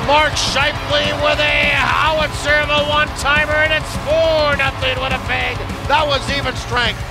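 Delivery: fast, excited goal-call commentary; rapid hockey play-by-play. Acted. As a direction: call the game live as it happens. Live goal calls from the game.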